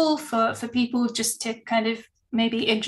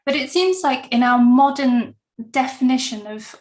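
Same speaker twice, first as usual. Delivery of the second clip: normal talking voice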